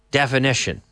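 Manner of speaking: ordinary talk, nothing marked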